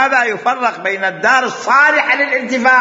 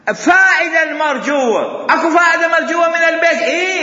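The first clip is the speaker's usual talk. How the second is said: emphatic shout; 8 semitones higher than usual. Real, not acted. Teacher presses the key point.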